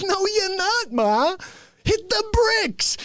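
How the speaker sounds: high, slow mock brush-off; mock gruff dismissal. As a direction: shoo his mother away. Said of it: "He's like".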